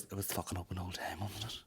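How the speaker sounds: deadpan ma. Retold or imitated. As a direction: mutter it like an unimpressed ma. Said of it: "she goes".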